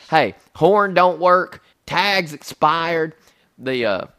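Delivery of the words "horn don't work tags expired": slow, punched list of faults; emphatic listing. Retold or imitated. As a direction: hammer each item slowly. Retelling his blunt list of faults.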